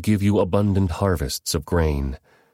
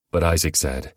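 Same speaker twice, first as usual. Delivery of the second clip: usual speaking voice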